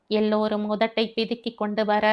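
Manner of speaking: ordinary delivery, nothing marked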